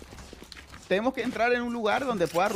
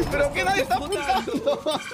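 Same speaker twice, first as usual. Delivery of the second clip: frustrated shout; 8 semitones higher, 4 dB louder, slower than usual. Real, not acted. Frustrated outburst at teammates.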